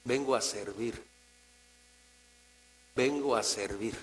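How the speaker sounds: meek, subdued acted prayer; humble servant. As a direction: offer yourself quietly, with pauses. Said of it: "No, eso no es un justo".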